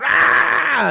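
put-on sudden high, loud wail of a hurt child; imitating a kid's shriek; mock child's scream. Dad retelling his son's cry after a bang, high loud wail. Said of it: "and then a very loud".

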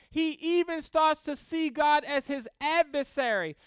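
ordinary delivery

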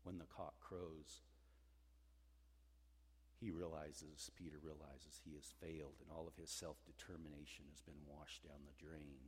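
hushed, low telling of a failure; low, quiet gravity; subdued, somber. A narrator's reading. Retells failure ending in weeping.

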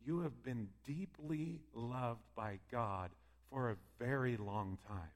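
soft and slow, hushed; tender. Acted. God speaking softly to the listener's spirit. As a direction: lay each word down gently. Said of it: "he's speaking to us and saying".

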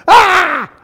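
short, loud, high mock scream; acted-out scream of alarm; imitated scream. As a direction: yelp like passengers on a dropping plane. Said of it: "everybody on the plane is kinda like".

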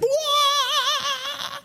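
one high loud electronic wail; hearing aid squeal. Retold or imitated. Preacher imitating hearing aids feeding back, a shrill loud squeal. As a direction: mimic the feedback shriek of hearing aids. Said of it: "reacting off of one another".

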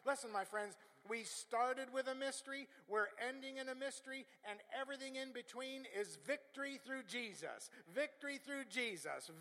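loud, high, ringing proclamation; sustained high-pitched pulpit cry; full-force preaching. Acted. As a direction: drive it home like a preacher building to a triumph.

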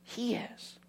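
breathless whispered "He is"; hushed reverent reply; whispered answer. Acted. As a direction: let it fall to a whisper. Answering the question softly.

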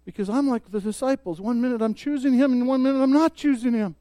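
usual speaking voice

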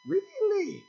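high, surprised squeak of "Really?"; mock surprise. Churchgoer hears of a new revelation, surprised. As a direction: act amazed by the offer.

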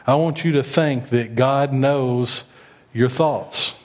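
usual speaking voice

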